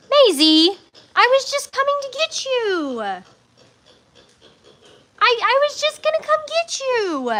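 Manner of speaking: squeaky greeting for a pet; high pet talk. Real, not acted. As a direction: coo at the pig in a high little voice. Greeting her pet pig Maisie.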